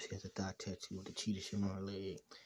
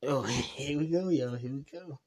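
laughing; 5 semitones higher, 12 dB louder than usual. Real, not acted. Laughing excitedly.

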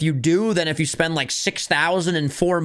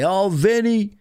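higher snide commenter voice; mocking commenter; 5 semitones higher than usual. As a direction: sneer it like a hater commenter. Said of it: "they would say".

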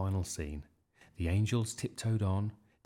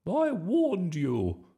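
high, loud, slow outrage; storming; 10 semitones higher, 7 dB louder, much slower than usual. A narrator's reading. Miss Boot raging at Bertie, storming. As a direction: thunder it with drawn-out fury. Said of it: "stormed Miss Boot".

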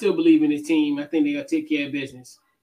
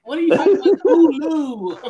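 higher, louder, laughing Baltimore Hulu; mock Baltimore accent; 9 semitones higher, 4 dB louder than usual. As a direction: ham up a Baltimore local saying Hulu. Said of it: "That's how they be in Baltimore".

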